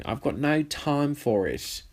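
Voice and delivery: normal talking voice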